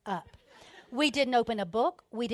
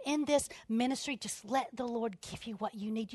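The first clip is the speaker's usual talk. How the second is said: ordinary delivery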